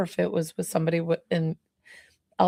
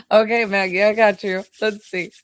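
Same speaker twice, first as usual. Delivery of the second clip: usual speaking voice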